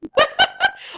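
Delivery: high bright burst of laughter; a high, loud laugh of surprise. Real, not acted. Laughing at the sponsor joke.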